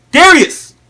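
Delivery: loud, high shout of a name; calling out. Acted. Father summoning his son to the demo, shouted call. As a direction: yell for your son to come.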